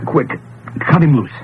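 loud urgent order; urgent shout. Acted. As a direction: bark it out fast. Urgent rescue of strangled man.

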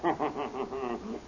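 soft self-satisfied laugh; gloating chuckle. Acted. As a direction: chuckle at your own triumph. Magician gloats over victory.

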